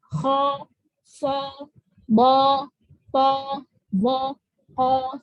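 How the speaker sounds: heavy half-round vowels on "Kho, so, bo, to"; thick, not pouted. Shown as a demonstration. Teacher drilling isti'la letters, thick without pouting. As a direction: raise tongue back, don't pout, on "Kho, so, bo, ko". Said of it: "Tapi nggak manyun juga".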